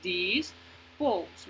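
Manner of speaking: "Dispose" broken into two beats, dis, pose; separado en sílabas. Shown as a demonstration. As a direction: say "Dispose" syllable by syllable. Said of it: "esta palabra tiene dos sílabas". ("Dispose" separado en dos sílabas)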